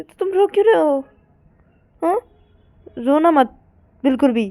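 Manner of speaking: loud, high-pitched chiding; emphatic scolding. Acted. Partner tells Payal not to cry, emphatic scolding. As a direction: order them firmly to stop.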